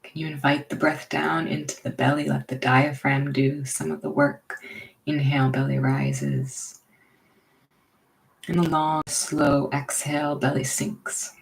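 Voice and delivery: unhurried, leave room for each breath; guided meditation. Real, not acted. Leading listeners into belly breathing, guided meditation.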